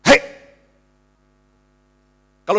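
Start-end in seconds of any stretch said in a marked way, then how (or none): 0.0-0.2 put-on parent barking hei at a naughty kid; short sharp scold; mock snap at child. Father scolding his naughty child with a sharp mock shout.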